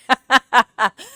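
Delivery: high, loud giggling laugh; burst of laughter. Real, not acted. Amused at chilling a whole shift, laughing. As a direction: crack up at your own remark.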